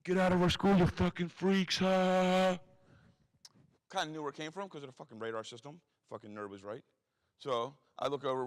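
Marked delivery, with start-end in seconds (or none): 0.1-2.6 loud, high jeering yell; a bully's taunting scream from behind trees; screaming insult. Retold or imitated. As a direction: bellow it at the freaks across the yard. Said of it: "you heard someone scream".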